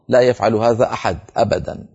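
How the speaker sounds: usual speaking voice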